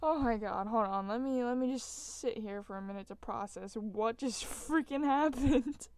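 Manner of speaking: loudly process the shock; stunned loud exclaim. Real, not acted.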